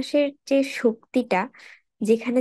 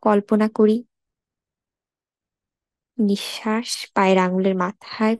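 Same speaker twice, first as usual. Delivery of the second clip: guided meditation. Acted. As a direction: lead a body scan unhurriedly, phrase by phrase. Leads a body scan meditation.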